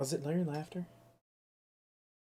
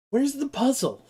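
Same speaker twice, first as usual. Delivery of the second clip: exasperated; 9 semitones higher, 4 dB louder than usual. Real, not acted. Exasperated rhetorical question.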